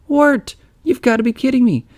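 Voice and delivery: put-on high incredulous girl's voice; Beatrice voice. A narrator's reading. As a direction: scoff in disbelief, pitched high. Beatrice scoffs at Wirt.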